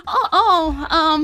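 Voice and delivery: high, dismayed little oh; mock dismayed oh. Nerixia reacts to losing her necklace, high and dismayed. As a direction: gasp out a startled oh. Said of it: "you see, like, a".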